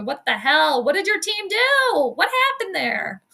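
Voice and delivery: high-pitched teasing disbelief; playful, high-pitched exasperation; mock outrage. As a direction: demand answers in mock shock, voice climbing. Teasing viewer about team's loss.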